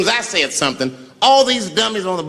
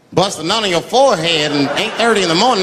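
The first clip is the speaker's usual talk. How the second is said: ordinary delivery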